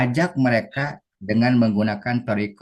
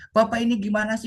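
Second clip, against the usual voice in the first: confused student; 13 semitones higher than usual. Retold or imitated. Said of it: "kata muridnya".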